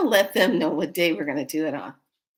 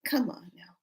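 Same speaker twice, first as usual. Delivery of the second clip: hushed chiding; 3 semitones higher, 8 dB quieter than usual. Retold or imitated. Her aside to the pranked men.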